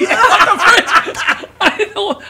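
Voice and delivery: slow, high laughing exclaim; laughing disbelief. Real, not acted. Comedian laughing at his own blunder, high and loud.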